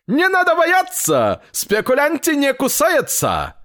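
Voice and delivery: very high, perky peddler; high rabbit voice. A narrator's reading. Rabbit peddler tells grandfather not to fear, high rabbit voice.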